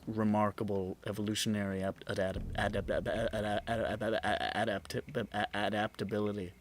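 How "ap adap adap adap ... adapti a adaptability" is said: robot stuck repeating a syllable; stuttering glitch. Acted. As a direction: glitch and stammer on the word. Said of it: "have a Porky Pig moment".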